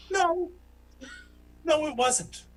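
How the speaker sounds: high-pitched protest; hammed-up objection; mock indignant denial. Retort to disapproving woman.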